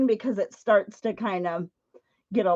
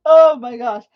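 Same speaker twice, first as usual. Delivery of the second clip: loud gasped-out exclamation; shaken relief; 10 dB louder than usual. Real, not acted. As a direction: burst it out, recovering from a scare. Recovering from spider scare.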